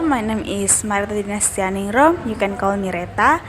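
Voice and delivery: usual speaking voice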